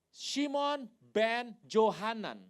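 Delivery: "Shimon, Ben, Johanan" loud, high, word by word; Hebrew pronunciation. Shown as a demonstration. Preacher teaches the Hebrew name, "Shimon, Ben, Johanan" projected separately. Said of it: "pakai bahasa Ibrani dapet nih".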